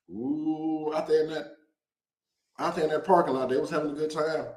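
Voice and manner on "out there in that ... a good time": ordinary talk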